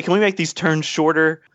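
ordinary delivery

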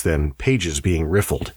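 ordinary delivery, nothing marked

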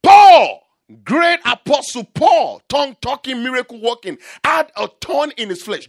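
shouted, high sermon proclamation; full-force preaching. Acted. Preacher introducing apostle Paul to the congregation, full-force preaching. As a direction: proclaim each phrase loud and high.

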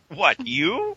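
mock surprise. Teasing a self-confessed pedant.

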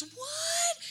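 put-on high child's shouted "What?"; child shouting what. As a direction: yell back like a kid who can't hear. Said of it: "He goes".

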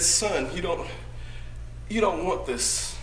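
a father quietly begging his son; pleading father. Acted. Father quietly pleading with his son to stay. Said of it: "he pleaded with me".

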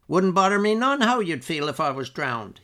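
lightly voiced bragging old man; old Uncle George. A narrator's reading. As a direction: brag like a spry old man pleased with himself.

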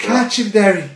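high-pitched mock plea to Derry; mimicked video cry. Mate quoting the bat video to his friend, high mimicry. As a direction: lightly imitate the clip's urgent call.

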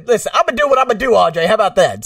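loud, high, rapid-fire retort; arguing back. Retold or imitated. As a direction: re-enact a defiant comeback, loud and fast. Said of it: "we got in that argument".

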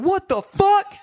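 high-pitched startled yelp; mock panicked outburst; retold freak-out. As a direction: yelp it high, acting out the shock. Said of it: "I was, like, freaking out".

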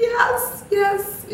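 very high, eager double yes; confirming to husband that her money was stolen, very high yes; emphatic yes. Retold or imitated. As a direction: squeak it out, relieved he finally gets it. Finally admitting money was stolen.

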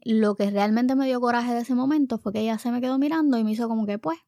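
plain reading voice

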